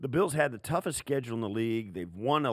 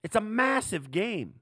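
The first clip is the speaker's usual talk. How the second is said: much higher and louder, excited; fired-up emphasis; 12 semitones higher, 6 dB louder than usual. Real, not acted. Analyst hyping a big Monday night game, fired up. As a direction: hammer it home, high and loud. Hyped about a massive game.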